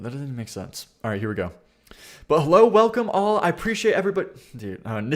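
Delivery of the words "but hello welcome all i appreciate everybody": loud, high-pitched welcome; big showy hello to the audience; video intro. Acted. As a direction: launch brightly into a host's greeting. Retries the interrupted video intro.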